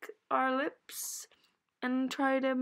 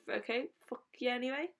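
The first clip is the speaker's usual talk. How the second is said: ordinary talk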